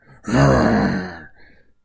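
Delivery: loud roaring growl. Shown as a demonstration. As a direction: roar it out like a T-Rex. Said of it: "Or maybe louder".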